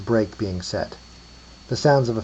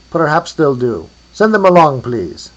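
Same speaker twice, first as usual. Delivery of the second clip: put-on loud, high Hungarian-accented call; 5 semitones higher, 9 dB louder than usual. A narrator's reading. Accepting the bones from afar, Hungarian accent. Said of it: "with a Hungarian accent".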